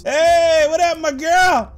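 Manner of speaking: high, loud drawn-out hail; playful greeting. Pretend. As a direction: call out a big hello. Playfully hails a game character.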